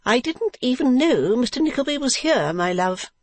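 high-pitched wife's voice; Madame Mantalini. Acted. Wife excusing herself to her husband, high character voice.

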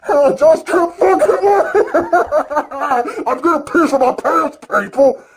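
high, laughing, can barely contain it; squealing with delight; giddy laughing excitement. Real, not acted. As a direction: gush with giggles breaking through. Giddy outburst about WrestleMania.

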